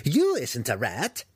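slow, high squawk of a crazed hermit; strained shriek. Acted. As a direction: exclaim it high in startled outrage. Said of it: "he shrieked in strained but understandable Drow".

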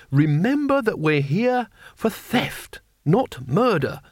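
slow, high, stretched-out reminder; schoolmasterly admonishing. A narrator's reading. Mister Saveloy reminding the gang of their purpose, admonishing. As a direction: spell it out as if to naughty pupils.

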